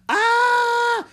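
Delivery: high, loud startled "Ah!"; boy screaming at a sudden snake, high and loud; yelled scream. Acted. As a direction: scream as something drops in front of you. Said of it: "Jack yelled".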